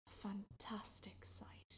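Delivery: a secret wonder whispered; hushed whisper. A narrator's reading. Storyteller hushing listeners in awe. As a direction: breathe it out in awe.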